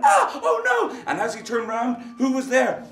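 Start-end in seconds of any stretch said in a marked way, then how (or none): 0.0-1.0 very high, loud, slowed cry; yelp of fright. Acted. The scratched thief wails high in fright. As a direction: wail in fright. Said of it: "He went".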